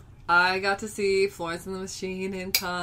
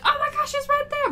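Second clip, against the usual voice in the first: much higher pitched thrilled exclamation; excited fan; 4 dB louder than usual. Retold or imitated. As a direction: point out the star in disbelief. Star appears nearby in crowd.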